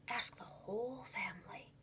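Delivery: secretive whisper to his son. A narrator's reading. Dad takes Clayton aside with a secret tip, whispering. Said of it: "he whispered".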